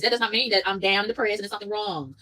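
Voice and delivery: normal talking voice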